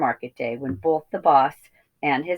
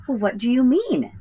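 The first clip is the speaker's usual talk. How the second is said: put-on high, puzzled young piglet; 8 semitones higher than usual. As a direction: ask it confused. Babe puzzled.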